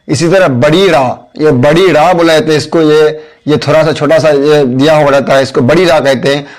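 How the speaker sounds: usual speaking voice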